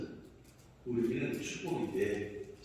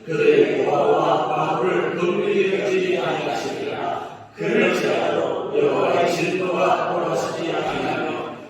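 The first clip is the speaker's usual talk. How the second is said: loud, slow, sing-song recitation; unison scripture reading; 6 semitones higher, 14 dB louder than usual. Acted. Pastor reading Isaiah in unison with the congregation, loud sing-song chant. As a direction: chant the verses together with the church.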